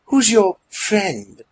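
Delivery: slow, high, pointed question; very suspicious. A narrator's reading. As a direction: draw it out with obvious suspicion. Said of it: "with a very suspicious tone in her voice".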